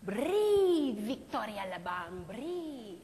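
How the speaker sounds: a gentle French mime master calling her in; Marcel Marceau voice. Retold or imitated. Mime teacher calling his student, lightly French-accented. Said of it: "This is the voice of Marcel Marceau".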